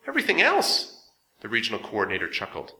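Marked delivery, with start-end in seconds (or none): 0.1-1.1 high, louder amused question; amused echo. A narrator's reading. Coordinator echoing Artaban's question, high amused voice. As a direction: toss the words back with a chuckle in them.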